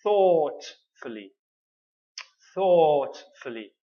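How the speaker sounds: slow demo; slow model word. Shown as a demonstration. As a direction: stretch the long o in thoughtfully. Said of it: "the three syllable word is".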